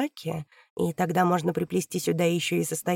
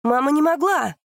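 loud outburst; 8 semitones higher, 7 dB louder than usual. Acted. Daughter defends mother in outburst.

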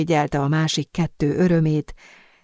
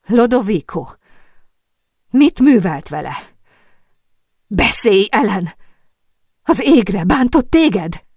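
raised, loud, outraged questioning; flashing anger; 5 semitones higher, 6 dB louder than usual. A narrator's reading. Sophie confronts Lodovico over the chained Ellen, angry and alarmed. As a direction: demand answers in loud, urgent alarm. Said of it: "majd villámló szemmel Zambellihez fordult".

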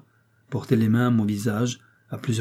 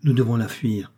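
ordinary delivery

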